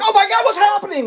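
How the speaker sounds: high, alarmed cry; panicked. Retold or imitated. Driver behind panicking at sudden braking. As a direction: voice jumping up in a mock panic. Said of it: "people behind you can't be like".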